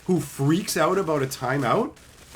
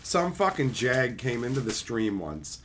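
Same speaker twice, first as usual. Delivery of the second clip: normal talking voice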